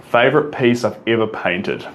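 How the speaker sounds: ordinary talk, nothing marked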